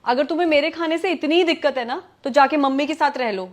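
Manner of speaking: heated dismissal; angry. Acted. Wife tells husband to go live with mother, angry. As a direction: send him off in a temper. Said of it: "इतना गुस्सा क्यों कर रही हो".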